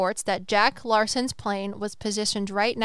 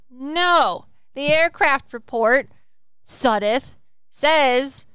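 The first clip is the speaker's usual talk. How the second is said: loud, higher-pitched correction of an opponent; emphatic rebuttal; 4 semitones higher, 8 dB louder, much slower than usual. Acted. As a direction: push back hard, punching each phrase. Acted rebuttal of Sudduth's claim.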